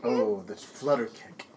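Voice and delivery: low, soft, slow mock-poetic voice; mocking imitation. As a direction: mimic the co-host's musing, slowed right down. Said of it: "you sound like you're all like".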